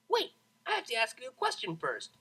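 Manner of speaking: high, eager pony voice; cheerful filly cutting in; bubbly pegasus. A narrator's reading.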